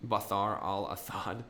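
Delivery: Bathar al-Athad with th for every s; lisp. Pretend. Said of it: "someone saying Bashar al-Assad with a fucking lisp".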